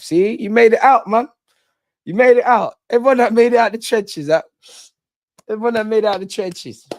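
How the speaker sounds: loud, high hyped praise; loud celebratory hype; excited shout. Real, not acted. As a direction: shout it out proudly for the married friend.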